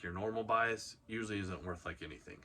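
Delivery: ordinary talk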